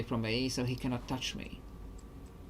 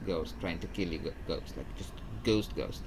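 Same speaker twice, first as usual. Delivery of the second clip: ordinary talk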